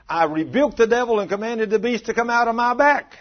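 high, loud retold rebuke; commanding rebuke. Preacher acting husband rebuking the devil, commanding rebuke. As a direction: call it out high and forceful, as the man did.

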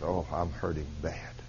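breathy whisper of a woman in pain. Retold or imitated. Preacher voicing the sick woman in pain, whispered. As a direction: whisper it as if it hurts to speak.

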